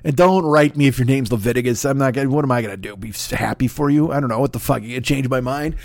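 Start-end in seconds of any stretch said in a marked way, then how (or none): none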